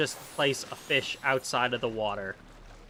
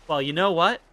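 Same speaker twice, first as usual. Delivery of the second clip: ordinary talk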